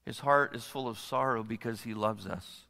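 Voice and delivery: low, soft and tender; gentle sorrow. Real, not acted. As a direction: say it quietly, weighted with sorrow. First take, sorrow alone, said gently.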